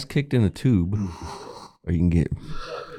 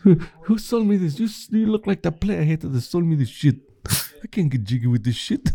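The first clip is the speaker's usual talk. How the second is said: Michael Blackson impression; 9 semitones higher than usual. Friend asks for the scene, high mock accent. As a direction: perform the scene on request. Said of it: "do me what you can".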